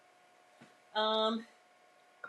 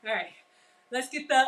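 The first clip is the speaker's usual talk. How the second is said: ordinary talk, nothing marked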